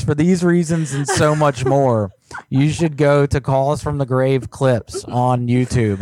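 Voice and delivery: normal talking voice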